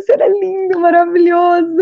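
high, rapt cry of thanks to God; overjoyed praise. Retold or imitated. New mother sees healthy baby, high overjoyed praise. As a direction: gush with wonder and relief.

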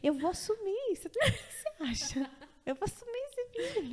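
a light, high mock of the husband vowing to take the baby; hammed-up husband with a laugh in it; wife mimics her husband's eager promise, high and laughing; imitating husband. Husband voiced promising to raise child.

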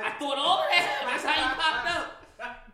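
loud laughing retelling. Real, not acted. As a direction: laugh through the words.

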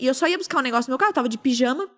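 ordinary delivery

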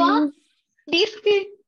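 high, sarcastic "ah, disque"; sarcastic scoff. Retold or imitated. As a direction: scoff it off with disbelief. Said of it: "me dijo eso y yo".

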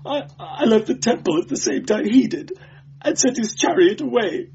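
high, tearful, pleading whimper. A narrator's reading. Beaten clerk confessing to the inquisitor, sobbing whimper. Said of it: "whimpering like a beaten dog".

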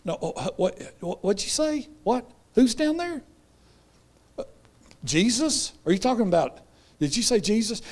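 high, incredulous stammer at first, then a high pitched disbelieving John; startled John. Retold or imitated. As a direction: play John caught off guard in prison, voice jumping up in shock. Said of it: "And John's sitting there going".

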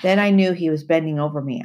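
ordinary delivery, nothing marked

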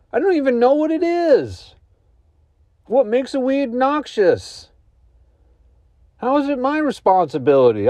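high-pitched comic indignation, pitch leaping up; worked-up and incredulous, voice way up high; exasperated disbelief. Acted. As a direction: protest in baffled, squeaky outrage. Acted indignant rant about the sign.

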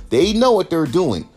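ordinary talk, nothing marked

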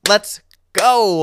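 loud, high hype; excited cheer. Real, not acted. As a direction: shout it with joy.

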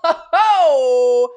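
loud "ho, ho" laugh; hearty laugh. Real, not acted. Host laughs at his own question, loud hearty laugh. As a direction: burst out laughing.

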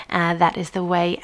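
ordinary delivery

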